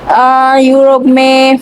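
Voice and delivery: ordinary delivery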